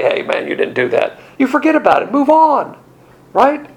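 ordinary talk, nothing marked

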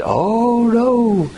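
lightly voiced disapproving mother; a hint of a fussy church mama; mimicking Mama. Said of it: "Because Mama always said".

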